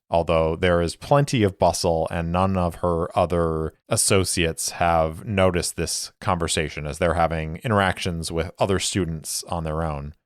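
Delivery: ordinary talk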